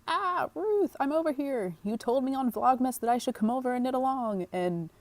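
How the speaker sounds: playful high-pitched viewer voice; eager viewer. Pretend. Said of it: "you're like".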